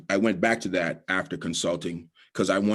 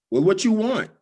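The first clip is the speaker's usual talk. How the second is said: rude waitress; 11 semitones higher, 5 dB louder than usual. Retold or imitated. Rude waitress voiced in story.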